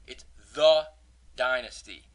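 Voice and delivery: "it's the Dynasty" with THE shouted; stress on THE. Shown as a demonstration. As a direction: bark "the" loud, pause, then Dynasty. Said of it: "just Dynasty".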